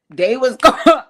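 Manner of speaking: loud words breaking into laughter; real laugh cutting off the words; laughing too hard. Real, not acted. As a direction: try to talk and burst out laughing. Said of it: "let me stop laughing".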